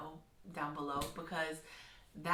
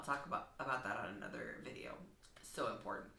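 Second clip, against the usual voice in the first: 1 whispered aside; 7 semitones lower, 6 dB quieter than usual. Real, not acted.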